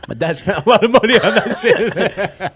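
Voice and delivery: laughing through the words. Real, not acted. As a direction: crack up while saying it. Cracking up at dad's purchase.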